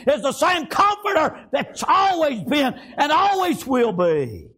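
high, driving revival preacher delivery; impassioned pulpit cry; fervent preaching. Real, not acted. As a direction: preach it high, drawing out the last phrase. Preaches at full force.

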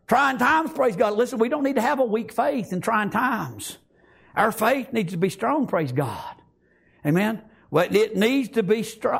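usual speaking voice

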